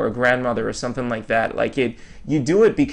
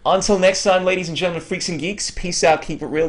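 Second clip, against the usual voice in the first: higher, upbeat host outro; podcast sign-off; 5 semitones higher than usual. Acted. Host signs off to listeners, upbeat announcer voice. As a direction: sign off the show with energy. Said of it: "podcast sign-off".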